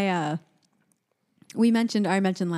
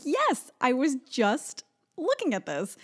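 excited; 6 semitones higher, slower than usual. Retold or imitated. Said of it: "And I was like".

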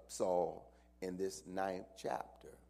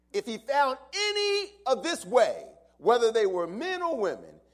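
much higher, louder preaching shout; preaching at full force; 13 semitones higher, 15 dB louder than usual. Acted. Pastor repeating the verse to the church, preaching at full force. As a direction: hit the repeat with full fire.

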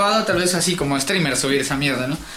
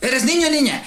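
pitched-up retold question; asking a child; 7 semitones higher than usual. Gamers asking a young player boy or girl, retold questioning. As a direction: put the question teasingly to a little kid. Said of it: "una vez con un niño".

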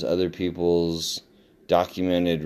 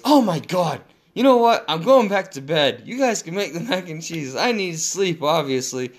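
much higher and louder, a hammed-up teen meltdown; mock exasperated outburst; 11 semitones higher, 3 dB louder than usual. Son embarrassed at his mistake, hammed-up exasperated outburst. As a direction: throw up your hands as the embarrassed son. Son groans about going back to bed.

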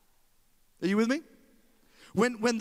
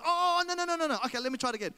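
flustered backpedal; 8 semitones higher, faster than usual. Retold or imitated. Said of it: "I was like".

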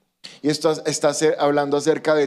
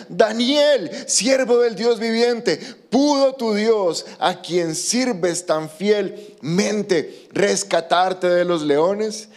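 higher and slow, a drawn-out anguished cry to Daniel; anguished call; 6 semitones higher, much slower than usual. A narrator's reading. The king calls into the lions' den for Daniel, higher, slow, anguished. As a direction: call down into the pit, dreading the answer. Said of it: "gritó con angustia".

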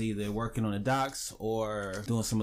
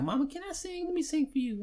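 put-on very high, eager child pleading; little kid voice. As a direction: ask mom like an excited young boy. Kid self asking mother.

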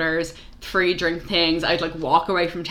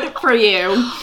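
higher, louder mock congratulation; sarcastic retort; 5 semitones higher, 5 dB louder, slower than usual. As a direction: fire back with mock admiration.

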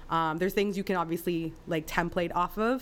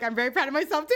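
high, louder smug self-praise; 9 semitones higher, 5 dB louder, faster than usual. Retold or imitated. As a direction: play it smug and pleased. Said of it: "I thought you were gonna be like".

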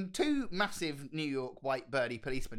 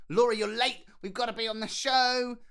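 louder, higher hurrying producer; lightly acted urgency; 7 semitones higher, 6 dB louder than usual. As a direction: hurry a late performer to the stage, lightly acted. Producer hurrying the star, acted quote.

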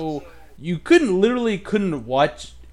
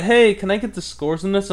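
chipper caller; 8 semitones higher, 7 dB louder than usual. Retold or imitated. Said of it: "you call 'em up like".

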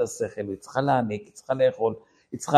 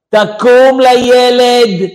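long, slow, high shout; imitated wife hollering at a sleeper; wife's wake-up yell; 10 semitones higher, 14 dB louder, much slower than usual. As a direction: drag out a loud mock order. Wife wakes husband at night.

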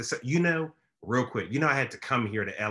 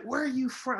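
much higher, curious question; girl on set asking her origin; imitating the girl; 12 semitones higher, 3 dB quieter than usual. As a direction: voice the puzzled girl. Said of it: "she's like".

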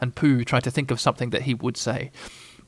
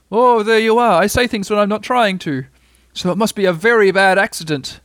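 raised, emphatic bear voice; puzzled, bothered Pooh; 7 semitones higher, 5 dB louder than usual. A narrator's reading. Pooh believing he says things without trying, bothered. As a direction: reason it out with growing concern.

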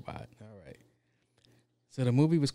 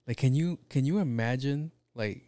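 ordinary talk, nothing marked

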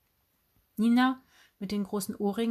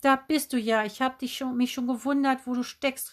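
raised, higher calling voice; freudiges Rufen; 5 semitones higher, 3 dB louder than usual. A narrator's reading. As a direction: call out to someone just found. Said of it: "rief Tante Heidrun". (mit erhobener Stimme gerufen)

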